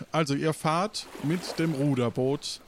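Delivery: ordinary talk, nothing marked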